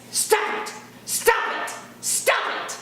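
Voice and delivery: hissed, whispered repeated scolding; harsh whispered scolding. Retold or imitated. Dad on plane scolding crying baby, harsh whisper. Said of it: "The dad spends the whole flight doing this".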